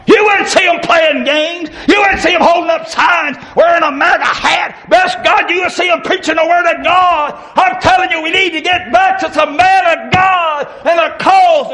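fiery street preacher shouting at the congregation; preaching at full force. Acted. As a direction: build the sermon into a shouting call.

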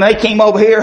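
ordinary talk, nothing marked

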